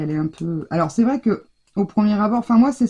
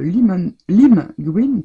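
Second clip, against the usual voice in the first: English colour name in a French accent; 6 dB louder, much slower than usual. Real, not acted. Crafter reading out a paint name to viewers, French-accented English. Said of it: "avec mon accent anglish très particulier".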